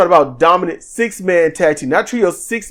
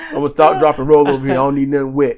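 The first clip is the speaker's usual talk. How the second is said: low-pitched deadpan bit; deadpan mock stubbornness; 6 semitones lower, faster than usual. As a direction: play the stubborn burning man, low and unbothered. Burning man absurdly refusing water.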